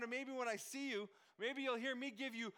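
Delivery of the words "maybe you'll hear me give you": ordinary talk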